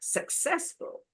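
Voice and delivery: "Successful" stressed on its second syllable, CESS; soft demo of "Successful", leaning on CESS. Shown as a demonstration. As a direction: say "Successful" with the middle syllable strongest. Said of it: "Second, correct".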